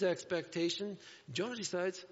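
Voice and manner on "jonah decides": ordinary delivery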